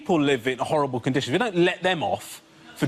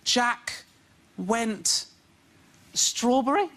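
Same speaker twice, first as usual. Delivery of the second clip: put-on slow, halting, high kid voice; child reading aloud; 5 semitones higher, much slower than usual. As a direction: sound out each word like a struggling child. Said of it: "when my son goes".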